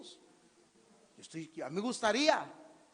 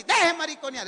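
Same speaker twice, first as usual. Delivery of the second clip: high, loud scolding; punchy raised-voice telling-off; forceful; 7 semitones higher, 8 dB louder than usual. Shown as a demonstration. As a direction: repeat the order hard. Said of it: "pero fuerte".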